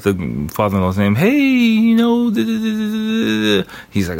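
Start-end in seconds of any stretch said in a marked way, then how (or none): 1.1-3.7 high-pitched mock chatter, drawn out; chatty son-in-law. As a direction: imitate someone prattling on in a high voice. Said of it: "by him talking and, and, and saying".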